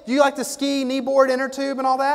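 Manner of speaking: high, loud, eager enthusiasm; gushing salesman. Retold or imitated. Salesman bonding with a customer over the lake, high and eager. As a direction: gush about the lake like a hyped salesman. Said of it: "He said".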